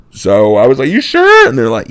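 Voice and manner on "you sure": high incredulous question; can't believe it's fine; doubtful kid. Retold or imitated. Boy doubting the doctors, high incredulous.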